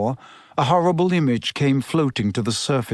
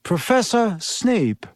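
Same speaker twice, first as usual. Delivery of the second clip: higher, slow, musing repetition; thoughtful echo; 7 semitones higher, slower than usual. A narrator's reading. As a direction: repeat the name slowly as a pondering question. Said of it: "looked thoughtful".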